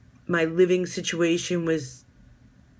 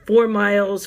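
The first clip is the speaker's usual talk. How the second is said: usual speaking voice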